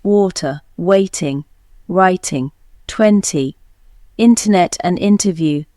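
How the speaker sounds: British accent, crisp clear T in "water, twenty, internet"; British accent: sound every T in "bitter" and "twenty"; British accent T demo. Shown as a demonstration. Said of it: "But in British English, we pronounce T".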